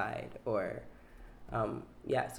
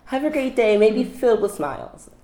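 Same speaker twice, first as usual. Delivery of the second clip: very high, sugary goodbye to a customer; bubbly cashier farewell, over the top; customer service voice; 12 semitones higher, 10 dB louder than usual. Shown as a demonstration. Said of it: "Can I hear it?".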